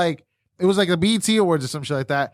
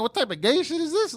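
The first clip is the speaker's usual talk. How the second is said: higher, baffled outburst; incredulous; 9 semitones higher than usual. Retold or imitated. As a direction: sound completely weirded out. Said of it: "like, saying".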